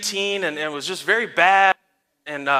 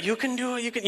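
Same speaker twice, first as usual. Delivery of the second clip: soft, raised coaxing voice; gentle encouragement; 3 semitones higher, 9 dB quieter than usual. Retold or imitated. Dad encouraging tearful daughter in driveway, soft coaxing. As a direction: softly reassure a small child.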